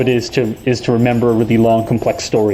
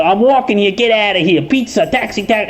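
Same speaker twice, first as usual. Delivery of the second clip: hammed-up New York accent, much higher; mock New York accent; 8 semitones higher than usual. Outsider makes fun of a New Yorker, mock New York accent. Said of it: "making fun of you for talking funny".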